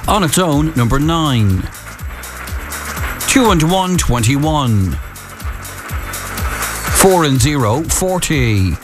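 drawn-out bingo calling, numbers spaced; bingo caller. Acted. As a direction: call each number like a bingo hall.

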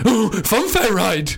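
put-on slow, gasping excited kid; a wide-eyed child spotting a ride; childlike. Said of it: "like a child".

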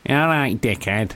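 nasal Manchester greeting; a hint of Manchester nasal; Manchester accent. Shown as a demonstration. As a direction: clip it like a Manc on the street. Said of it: "Real nasal, innit".

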